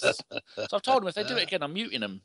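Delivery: ordinary delivery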